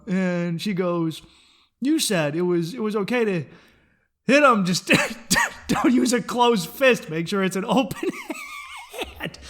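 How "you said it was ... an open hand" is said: retold quote breaking into giggles; words broken by bursts of laughter; laughing. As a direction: crack up while quoting. Retelling quote, cracking up.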